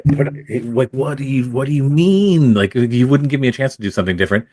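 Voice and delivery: high-pitched retold disbelief; incredulous protest. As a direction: protest as if baffled by the decision. Retelling his protest at losing role.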